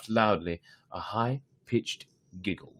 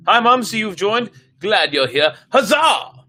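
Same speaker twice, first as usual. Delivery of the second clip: high, loud cheery hello to a newcomer; excited greeting; 12 semitones higher, 10 dB louder than usual. Real, not acted. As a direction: greet them with a burst of delight. Reader greets a viewer joining.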